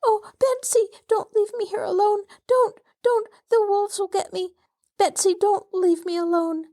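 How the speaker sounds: frantic scream. A narrator's reading. Terrified child begging her cousin not to leave, frantic. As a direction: scream with terror at being left. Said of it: "Molly's crying rose to a frantic scream".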